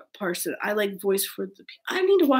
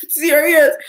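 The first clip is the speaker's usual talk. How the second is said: usual speaking voice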